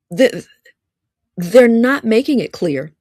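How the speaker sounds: ordinary talk, nothing marked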